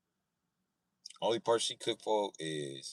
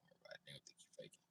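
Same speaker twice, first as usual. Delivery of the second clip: fast hushed whisper; sneaky fast whisper; 5 semitones lower, 28 dB quieter, much faster than usual. Retold or imitated. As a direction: whisper it behind his back.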